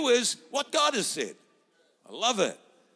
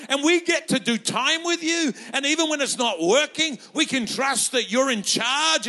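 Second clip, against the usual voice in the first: high, loud, fervent declaration building in faith; passionate confession; 6 semitones higher, 6 dB louder than usual. Acted. Peter declaring to Jesus who he is, loud and fervent. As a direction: proclaim it like Peter with fire.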